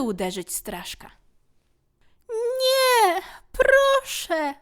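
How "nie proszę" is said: very high, loud pleading cry; tiny creature screaming in fear; terrified cry. Acted. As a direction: beg for mercy in panic. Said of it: "krzyknął przerażony straszek".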